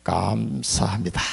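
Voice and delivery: doa lembut dan pelan, hampir berbisik di akhir; softly and slowly say thanks like a child to a father; tender prayer. Shown as a demonstration. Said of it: "이러면 나를 거듭나게 해주셔서 감사합니다".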